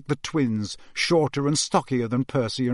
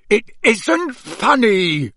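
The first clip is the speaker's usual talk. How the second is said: slow, high, loud scolding; exasperated father's drawn-out yell; angry shout; 8 semitones higher, 6 dB louder, much slower than usual. A narrator's reading. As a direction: shout it word by word. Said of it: "Mister Weasley shouted".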